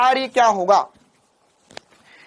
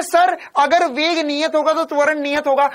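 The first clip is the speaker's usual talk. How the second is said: mocking student; 6 semitones higher than usual. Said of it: "अब बोल रहा है".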